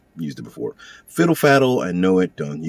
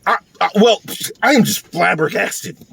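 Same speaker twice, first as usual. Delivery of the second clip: overboard flabbergasted act; 8 semitones higher than usual. Acted. Someone accused protesting too much, overboard mock astonishment. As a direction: overdo being flabbergasted, pitch way up. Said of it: "You're going overboard".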